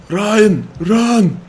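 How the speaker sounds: sudden high loud call to run; urgent shout. Real, not acted. Player shouting at companion Ryan to run, urgent yell. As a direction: yell a warning at a friend. Warning companion during a fight.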